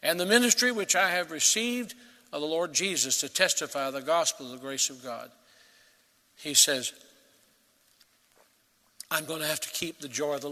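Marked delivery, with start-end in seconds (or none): none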